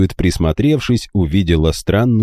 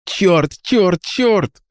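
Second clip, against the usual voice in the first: cursing; 12 semitones higher, much slower than usual. A narrator's reading. Frustrated cursing over sleeping friends.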